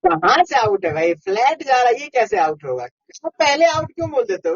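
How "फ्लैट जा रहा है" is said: usual speaking voice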